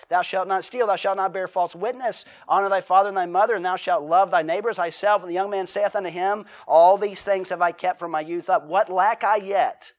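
loud, proclaiming scripture reading; preacher booming the Bible text. Acted. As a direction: read the commandments out to the congregation. Reading the commandments aloud.

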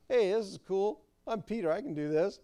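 lightly voiced swaggering Peter; cocky Peter. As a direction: brag like a showoff on the water. Acting cocky Peter on water.

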